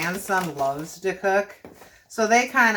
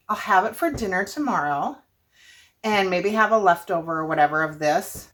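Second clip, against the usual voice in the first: ordinary talk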